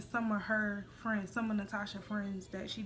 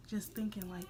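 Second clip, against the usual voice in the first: ordinary talk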